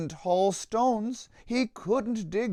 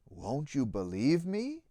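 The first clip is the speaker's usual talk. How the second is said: softer, lower, pleading; sad cry; 6 semitones lower, 5 dB quieter than usual. A narrator's reading. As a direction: beg to be believed, downcast. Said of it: "the sad donkey cried".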